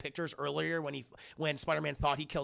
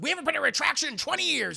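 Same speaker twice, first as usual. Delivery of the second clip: high, loud, rapid-fire indignation; mock outrage; 8 semitones higher, 5 dB louder, faster than usual. Boss balks at printing a retraction, high loud outrage. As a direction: fire it back high and fast.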